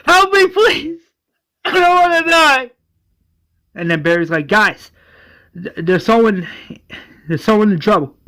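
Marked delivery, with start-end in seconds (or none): 0.1-2.7 very high, loud acted plea; screaming for his life. Dangling man begs for rescue, screaming. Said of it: "the guy screaming for his life". 4.5-8.2 higher, louder, stumbling alarm; urgent alert. Retold or imitated. Barry tells team someone's in trouble, urgent.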